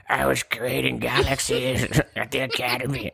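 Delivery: slow, laughing monster impression; Predator. Co-host guessing how the alien would talk, slow goofy creature voice. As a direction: drag it out in a silly alien voice, breaking into laughs. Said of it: "I don't know how the Predator talks".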